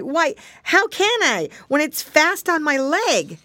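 high-pitched protesting piglet; Curly crying out. A narrator's reading. Piggy boy reasoning with the snail, high protesting cry. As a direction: protest in a high little pig's voice. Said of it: "cried Curly".

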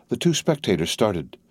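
usual speaking voice

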